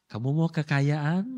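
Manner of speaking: lightly voiced tempter, low with a rising lilt; a hint of a low-voiced tempter; tempting offer.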